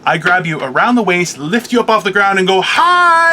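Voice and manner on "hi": a sudden, high, loud "Hi!"; loud mock greeting. Friend grabbed from behind, hollered high and loud. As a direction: blurt it out to startle them.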